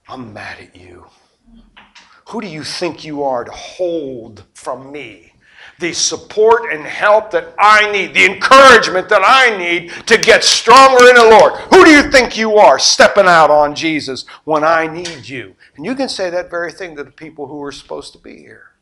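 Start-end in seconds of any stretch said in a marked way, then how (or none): 0.1-1.1 hushed, hissed complaint; whispered anger. Acted. Believer whispering anger at an absent friend. 2.3-15.5 indignant. Acted. Believer confronting a friend who stepped away, indignant.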